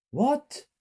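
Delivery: very high, loud startled question; high-pitched shocked child. A narrator's reading. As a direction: shoot the pitch up in disbelief. Shock at figure not being Dad.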